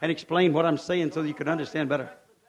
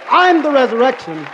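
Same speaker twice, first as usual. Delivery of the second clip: soaring shouted preacher's cry, much higher and louder; preaching at full force; 10 semitones higher, 15 dB louder than usual. Acted. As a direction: lift the church with every word.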